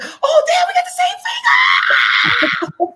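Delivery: excited exclamation. Retold or imitated. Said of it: "I said".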